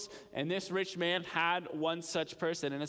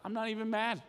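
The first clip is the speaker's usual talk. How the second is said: higher-pitched, grudging admiration; impressed disbelief; 5 semitones higher than usual. Acted. Someone reacts to a creatively broken rule, impressed. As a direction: concede that you're impressed. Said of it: "that's just impressive".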